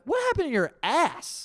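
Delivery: high-pitched incredulous question; aghast disbelief. Real, not acted. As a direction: ask it aghast, voice leaping way up. Aghast reaction to huge dump story.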